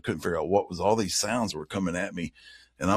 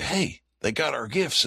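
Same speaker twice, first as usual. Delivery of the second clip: pitched-up, drawn-out happy exclamation; excited; 8 semitones higher, slower than usual. Retold or imitated. Camper recalling joy at owls finding their gifts, excited. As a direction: retell it with slow, high delight. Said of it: "And so we were excited".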